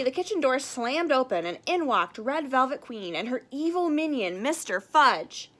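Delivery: plain reading voice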